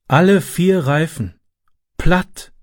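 slow, downcast sigh of a reply; dejected. A narrator's reading. Car owner finds all four tyres slashed, dejected. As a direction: let the words sink, defeated. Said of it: "sagte er niedergeschlagen".

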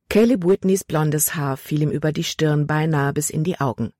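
usual speaking voice

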